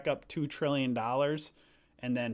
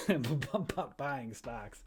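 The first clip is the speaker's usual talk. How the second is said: laughing; 5 dB quieter, slower than usual. Real, not acted. Laughing through the word.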